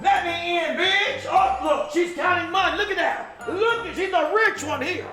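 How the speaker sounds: high sharp yelling; loud sharp voice. Acted. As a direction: shout it through the room. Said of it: "Why are you yelling?".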